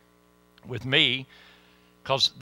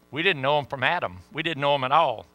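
ordinary talk

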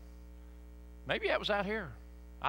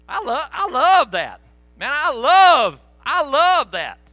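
loud exulting; 6 semitones higher, 11 dB louder, slower than usual. Real, not acted. Preacher exulting over remembered worship.

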